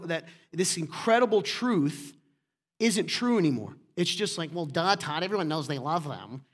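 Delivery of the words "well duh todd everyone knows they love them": put-on dim, know-it-all voice; mock them with a dopey "well, duh"; imitated dopey churchgoer. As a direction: caricature of a smug listener. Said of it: "that's how you sound".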